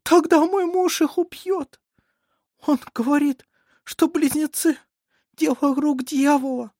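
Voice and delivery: high, strained tearful voice; a distraught mother pouring out her fear; anguished dread. A narrator's reading. Weeping mother fears for her twins.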